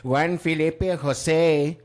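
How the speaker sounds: put-on slow, rolled Spanish accent on the name; mocking news anchors switching to a Spanish accent; newscaster Spanish accent. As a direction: newscaster in Spanish accent, Juan Felipe Jose stretched out. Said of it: "They're like".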